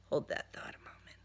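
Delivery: breathy unvoiced aside; whisper. Narrator whispering an aside to the listener. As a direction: hush it, just between us.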